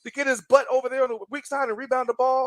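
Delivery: high-pitched exasperated mock yell; imitated coach yelling. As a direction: yell it like a coach fed up with a player. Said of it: "he would yell at me".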